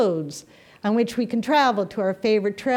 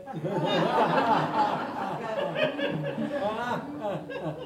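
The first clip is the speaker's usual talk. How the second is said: soft chuckle at the punchline; laughing at own joke; 6 dB quieter than usual. Real, not acted. Preacher laughs at her own joke, soft chuckle. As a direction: let a real laugh bubble out.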